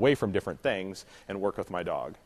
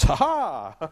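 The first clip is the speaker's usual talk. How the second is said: louder, higher gloating laugh; mock dog cackle; voicing the dog; 7 semitones higher, 7 dB louder than usual. Shown as a demonstration. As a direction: play the dog's triumph. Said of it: "And the dog goes".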